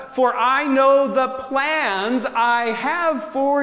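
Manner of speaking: slow. Acted. Dramatic reading of verse.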